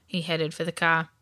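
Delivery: usual speaking voice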